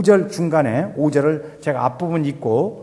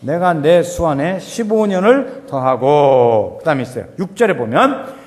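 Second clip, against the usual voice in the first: measured, slow, louder reading of the verse; scripture reading; 5 dB louder than usual. Acted. Preacher reading the Bible passage to the congregation, solemn reading. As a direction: read the holy words with weight.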